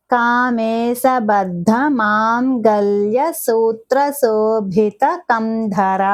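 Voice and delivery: the whole name drawn out, ధర lengthened at the end; slow careful recitation. Shown as a demonstration. Teacher modelling the full name for students, slow. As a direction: recite it slowly, stretching the last syllable. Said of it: "ఒత్తి పలకాలి".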